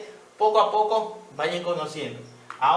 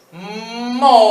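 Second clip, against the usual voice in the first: "Moneda" drawn out slowly and louder; 5 semitones higher, 10 dB louder, much slower than usual. Shown as a demonstration. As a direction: sound out "Moneda" slowly for the class. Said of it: "seguimos despacito". ("Moneda" despacito y fuerte)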